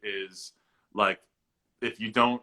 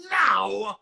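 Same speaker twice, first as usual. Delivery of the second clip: much higher, loud, slow gushing mom; imitating mom; 8 semitones higher, 6 dB louder, much slower than usual. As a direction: stretch it out like a doting mom. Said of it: "She's like".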